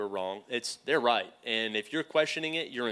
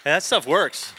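call-out; 4 semitones higher, 9 dB louder than usual. Real, not acted. Calls out after the story.

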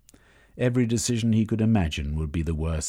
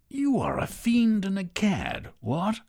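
much higher, pompous indignant toff; outraged old aristocrat; 14 semitones higher than usual. A narrator's reading. Lord Gorge to Misanthrope, pompous high outrage. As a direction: sputter it like an offended English lord.

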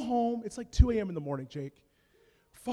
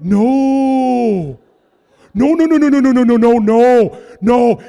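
loud repeated no's; frantic refusal; 3 semitones higher, 16 dB louder, slower than usual. Retold or imitated. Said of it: "I'm like".

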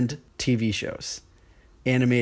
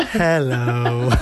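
automated robotic hello; 4 dB louder than usual. Shown as a demonstration. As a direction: imitate a text-to-speech voice. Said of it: "automated robot voices reading the text".

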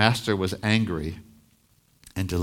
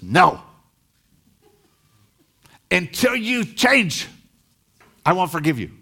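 angry refusal; 10 semitones higher, 4 dB louder than usual. Retold or imitated. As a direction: spit out the refusal coldly. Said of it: "And Russ gets angry".

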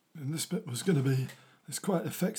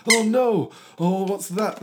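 high, loud cry of dismay; mock alarm; 7 semitones higher, 6 dB louder, slower than usual. Storyteller spots a new moon arrival, high loud alarm. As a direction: raise the alarm for the children.

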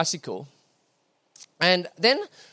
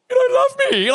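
high-pitched mock child's wail; hammed-up little boy's plea; frightened child; 4 dB louder than usual. Small son pleading with dad before the push, mock wail. Child's look voiced in a story.

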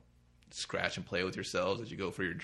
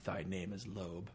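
mock solemn; 7 dB quieter, slower than usual. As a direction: intone it like scripture.

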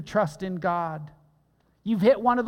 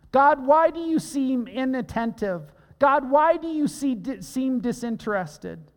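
anguished cry to God; 4 semitones higher than usual. Acted. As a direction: cry out desperately to a silent God. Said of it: "put a little Mattology in here".

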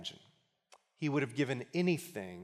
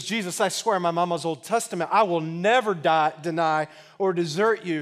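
loud, higher acted-out oath; insistent vow; 4 semitones higher, 8 dB louder than usual. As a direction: swear it emphatically, playing the disciple. Peter swears he stays loyal.

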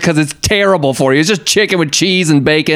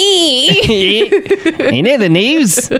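goofy voice; 11 semitones higher, much slower than usual. Pretend. Mock voice announcing news.

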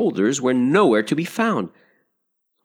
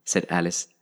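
ordinary delivery, nothing marked

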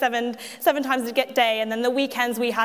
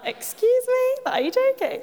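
much higher outraged squeak; indignant disbelief; 12 semitones higher than usual. Retold or imitated. To her friend about the boys, high indignant disbelief. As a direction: sound appalled and incredulous. Hearing they oppose women leaders.

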